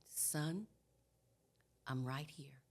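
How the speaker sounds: low, hushed reassurance to a newborn; soothing father. Retold or imitated. Husband to his screaming newborn son, soft and soothing. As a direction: imitate a dad quietly calming his son.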